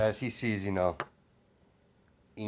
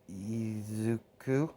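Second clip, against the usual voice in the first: slow, hesitant try at the word Izuku, rising at the end; a slow, unsure Izuku from a small boy; child repeating a new name; 6 dB quieter, much slower than usual. Retold or imitated. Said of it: "As he said".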